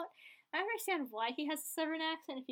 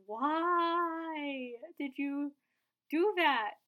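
long drawn-out WHY, ending louder; exasperated dismay; 3 semitones higher, 5 dB louder, much slower than usual. Real, not acted. Viewer asking Franco why he did it, dismayed. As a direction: wail the question in dismay, stretching it out.

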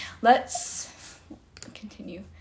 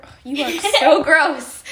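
laughing, louder, playful disgust; 5 semitones higher, 6 dB louder than usual. Real, not acted. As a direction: laugh through the gross-out. Dog slobbered on hand.

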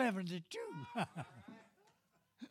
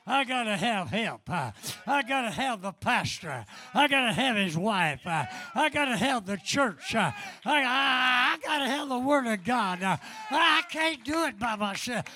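preaching; 4 semitones higher, 6 dB louder than usual. Acted. Preacher shouting testimony.

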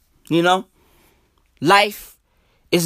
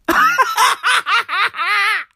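high cackling laugh; 13 semitones higher than usual. Real, not acted. Comedian cracks up at his own Holy Spirit joke, cackling laugh. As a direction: cackle helplessly at your own idea.

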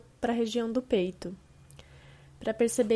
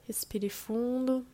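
slow, calm meditation voice with long pauses; guided meditation; slower than usual. Acted. Host guiding listeners through a body scan, slow and calm. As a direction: ease the listener down into relaxation, unhurried.

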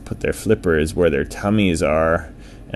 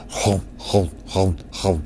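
munching noises; 5 dB quieter than usual. A narrator's reading. Whale gobbles algae.

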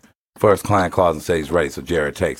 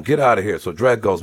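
normal talking voice

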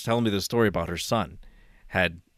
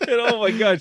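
laughing; 12 semitones higher, 4 dB louder than usual. Real, not acted. Laughing while telling the story.

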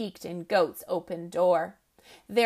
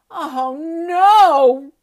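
loud, high "Oh, no!" in panic; alarmed cry; 6 semitones higher, 11 dB louder than usual. Acted. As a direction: gasp it out in horror. Rabbit fears shamrocks eaten.

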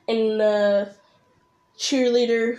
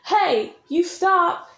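slow, raised "Hey, you stop" held back from a yell; the tamer, less inflected command; yelling without inflection; 7 semitones higher, much slower than usual. Shown as a demonstration. Creator shows how arguing does not sound, restrained command. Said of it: "It's not like".

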